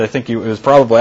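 ordinary talk

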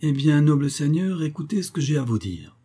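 put-on high-pitched, reassured old banker; lifted, newly settled address; suddenly serene. A narrator's reading. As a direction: regain composure and claim the floor. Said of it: "soudain rasséréné".

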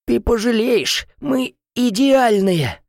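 high, slow, drawn-out moan; groaning threat.

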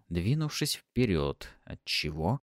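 ordinary delivery, nothing marked

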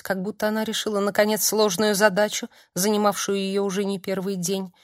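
plain reading voice

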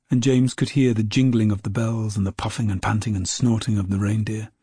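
ordinary delivery

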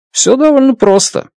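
usual speaking voice

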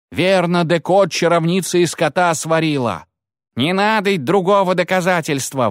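a mob whipping itself up; agitated peasant. Acted. Peasants demand the witch burn, agitated.

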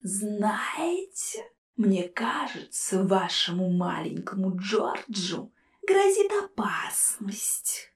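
slow, soft, teasing ghost whisper; playful skull. A narrator's reading. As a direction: toy with them in a whisper. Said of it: "игриво заявил череп".

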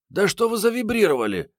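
high-pitched, surprised woman character; surprised Mariette. A narrator's reading. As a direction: pitch her far up in puzzled surprise. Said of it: "удивилась Мариетта". (удивлённо, высоким женским голосом)

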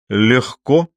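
brisk, eager agreement; mildly animated and confident; perking up. A narrator's reading. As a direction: jump in with sudden enthusiasm. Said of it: "оживился Иван".

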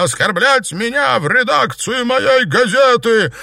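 high, loud, stretched-out outrage; furious. A narrator's reading. Editor insulted in her own newsroom, furious yell. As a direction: yell each word indignantly. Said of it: "разъярилась Ирина".

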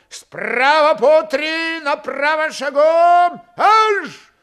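high, loud, drawn-out parade order; ringing military command. A narrator's reading. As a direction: call the squadron out with long stretched words. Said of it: "зычно подал команду".

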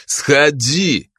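firm repeated command; insistent order. A narrator's reading. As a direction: say it again, no arguing. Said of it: "повторил Чиз". (настойчивый приказ)

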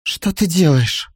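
startled, high and slow; frightened cry. Acted. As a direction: cry out in alarm. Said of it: "Испуганно вскрикнула". (испуганный вскрик)

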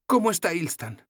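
voiced young heroine, gently teasing; Sefawin's voice. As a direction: play the tired, teasing young woman.

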